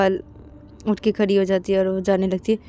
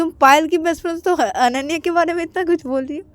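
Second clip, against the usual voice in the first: higher, probing question; quizzical friend; 9 semitones higher than usual. Acted. Aakash probing Sakshi, higher quizzical voice. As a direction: question her motive.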